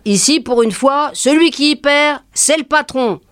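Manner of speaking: normal talking voice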